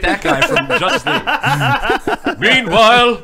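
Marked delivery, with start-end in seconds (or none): 2.4-3.2 superhero narrator. Pretend. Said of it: "Adam West Batman".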